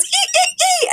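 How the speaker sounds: very high happy squeals. Retold or imitated. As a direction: squeal with delight. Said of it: "And she goes".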